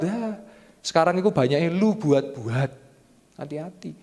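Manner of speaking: ordinary talk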